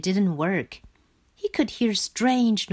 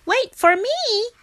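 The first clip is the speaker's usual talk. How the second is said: put-on high-pitched childlike call; high, urgent story shout; 4 dB louder than usual. A narrator's reading. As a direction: call after a friend in a high frog voice. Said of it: "when he heard a shout".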